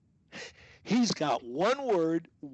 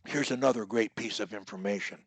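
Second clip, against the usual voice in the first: ordinary talk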